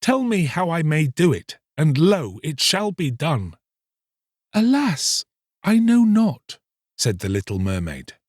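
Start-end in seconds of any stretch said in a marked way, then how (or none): 0.0-3.6 raised, eager promise; joyful vow. Acted. Fisherman vows to the mermaid, raised and eager. 4.5-6.6 very high, sorrowful mermaid voice; mermaid's sorrow. Acted. Mermaid answers the fisherman, high and sorrowful.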